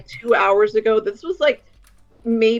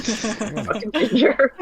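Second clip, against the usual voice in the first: laughing tease; 3 semitones higher, much slower than usual. Real, not acted. Teasing a friend, laughing.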